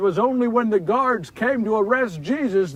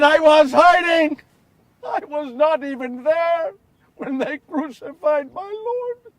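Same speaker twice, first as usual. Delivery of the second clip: anguished self-reproach; 6 semitones higher, 7 dB louder than usual. A narrator's reading. Peter condemning his own cowardice to listeners: loud anguish. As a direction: berate yourself, voice rising.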